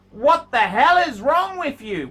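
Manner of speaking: loud, incredulous scolding; exasperated yell. Real, not acted. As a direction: blast him for being so picky. Scolding the watch owner.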